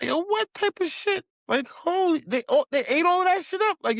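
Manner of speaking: can't believe what he's hearing; disbelief. Retold or imitated. Retold disbelief at crowd.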